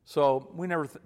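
usual speaking voice

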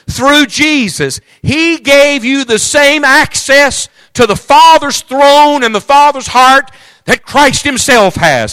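high, loud, slow preacher's climax; full-force preaching. Acted. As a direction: stretch every word out like a revival preacher.

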